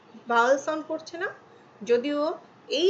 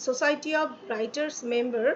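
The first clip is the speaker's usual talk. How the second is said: slow full phrase; much slower than usual. Shown as a demonstration. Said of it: "না দিয়ে আমি".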